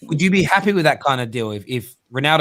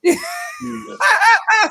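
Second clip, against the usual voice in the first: howling laughter. Real, not acted. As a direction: lose it completely. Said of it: "Sorry, I love it".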